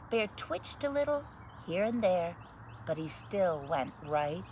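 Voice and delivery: put-on lively read-aloud voice for children; story narration. Reading a picture book to children, lively story narration. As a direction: read it like bedtime story time.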